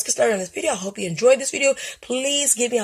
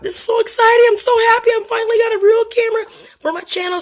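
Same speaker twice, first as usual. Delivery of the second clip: much higher, louder excited shout; real excitement; 13 semitones higher, 6 dB louder than usual. Real, not acted. Vlogger thrilled about first real camera, excited shouting. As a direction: burst with joy over the new camera. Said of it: "So if my voice goes up and down".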